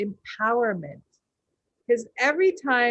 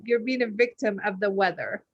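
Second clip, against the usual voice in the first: ordinary talk, nothing marked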